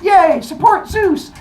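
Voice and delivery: high, loud mock crowd cheer; slow, high-pitched hurrah. Other gods cheering for Zeus, high and loud.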